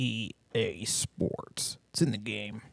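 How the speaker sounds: low, slow, breathy-sounding EA Sports line; sultry slogan whisper. Pretend. Slogan purred to partner as a new fetish, low and slow. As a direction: purr the slogan like pillow talk.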